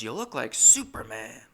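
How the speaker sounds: loud, impressed roommate impression; old roommate. Old roommate compliments narrator, loud imitated praise. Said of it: "And he goes".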